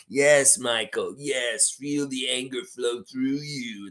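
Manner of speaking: slow sinister whisper; Darth Sidious whisper. Acted. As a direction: hiss it like an evil emperor. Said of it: "He's Darth Sidious".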